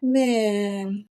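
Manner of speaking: normal talking voice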